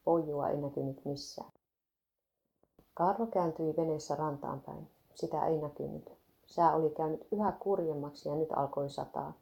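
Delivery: usual speaking voice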